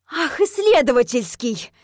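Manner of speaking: sarcastic, indignant bark; barked outrage. A narrator's reading. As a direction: throw it back furiously. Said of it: "рявкнула я".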